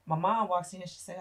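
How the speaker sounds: ordinary talk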